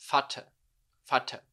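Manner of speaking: Vater said "fatah". Shown as a demonstration. Teacher corrects student, V as F. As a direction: start Vater with an F. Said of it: "tem som de F".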